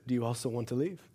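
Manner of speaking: soft, low, tender question; gentle voice of the Spirit. Retold or imitated. The Holy Spirit asking the speaker, soft and tender. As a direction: ask it softly, not as a threat. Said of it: "the Holy Spirit looks at me and says".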